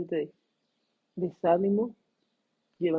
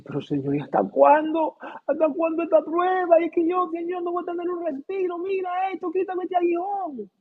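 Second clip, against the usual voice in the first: pleading cry to God; 11 semitones higher than usual. Retold or imitated. Paul begging God to remove his thorn, much higher pleading lament. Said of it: "Como el apóstol Pablo gritando ante Dios".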